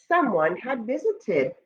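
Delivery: ordinary delivery, nothing marked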